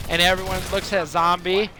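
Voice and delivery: normal talking voice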